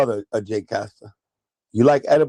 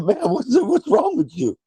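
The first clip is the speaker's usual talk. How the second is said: incredulous; 11 semitones higher than usual. Retold or imitated. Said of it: "I said".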